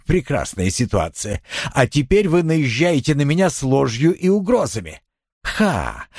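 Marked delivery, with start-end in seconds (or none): none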